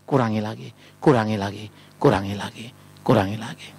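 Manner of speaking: very low repeated self-correction; low, subdued climb-down; chastened muttering. Acted. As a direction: drop deep and repeat it haltingly. Acting chastened parent reducing blame repeatedly.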